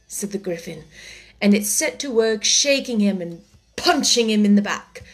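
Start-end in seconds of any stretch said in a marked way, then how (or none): none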